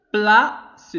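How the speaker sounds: "place" said loudly as two syllables, pla, ce; split into syllables. Shown as a demonstration. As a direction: sound out "place" with a gap before the final ce. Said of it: "Deux syllabes".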